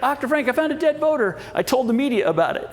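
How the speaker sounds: high-pitched mock excitement; excited. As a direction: imitate an overexcited tipster, voice jumping way up. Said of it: "some people get excited".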